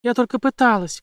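high-pitched shout back. A narrator's reading. Jane shouting back at the villain, high defensive cry. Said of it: "крикнула в ответ Джейн".

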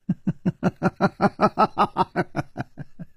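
long, mocking staccato laugh; cold laugh. A narrator's reading. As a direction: laugh coldly and drawn-out, in scorn. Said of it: "这个女子就冷笑起来". (一连串冷笑)

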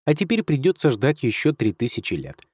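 usual speaking voice

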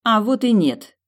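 quick stubborn denial, high and loud. A narrator's reading. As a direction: insist right back. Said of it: "упрямо возразила девушка".

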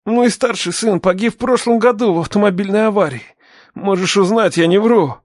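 anxious insistence. A narrator's reading. Captive explains his loss to the father, anxious.

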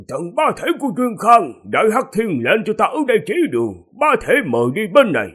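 high, booming god proclaiming; deity voice. Acted. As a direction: call out like a towering divine guardian. God voice calls out in story.

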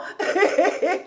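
high, teasing titter; old woman's laugh. Acted. Housekeeper teasing master about a pretty girl, high laugh. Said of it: "Elle riait de sa bouche édentée".

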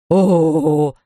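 long, pained "ooh"; drawn-out moan of pain; dentist's wail. A narrator's reading. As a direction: wail it out on a stretched vowel. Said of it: "гласил мистер Лич".

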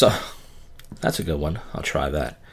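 normal talking voice

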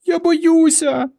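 very high, slow frightened squeak; a scared girl hiding behind trees; tiny terrified squeal; squeaking in fear. A narrator's reading. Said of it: "запищала Варка".

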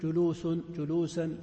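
جلوس as julusun, جلوسا as julusan; tanwin endings. Shown as a demonstration. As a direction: sound -un on جلوس, then -an on جلوسا. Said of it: "لأنها تقبل التنوين".

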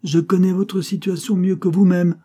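a fierce uncle exposing his nephew; terrible marquis. A narrator's reading. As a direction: crush him with what you know. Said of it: "le terrible gentilhomme".

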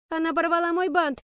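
high, indignant pupil's yell; wailed complaint. A narrator's reading. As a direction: accuse her loudly and high to the teacher. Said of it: "завопила Пенни". (вопль обиженной девочки)

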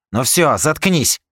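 loud, slow cutting order; angry snap. Acted. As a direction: shut him up hard. Rika cuts him off.

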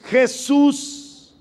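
normal talking voice

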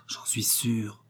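whispering it, breath only; a tender whisper repeating the words; soft repeated whisper, no voice. A narrator's reading. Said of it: "lui disait doucement".

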